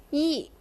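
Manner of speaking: "yi" short and sharply falling; sharp fourth tone. Shown as a demonstration. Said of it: "You kinda say it sharply".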